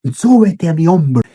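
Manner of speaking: a hint of a horse giving an order; talking horse. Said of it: "el caballo les dijo".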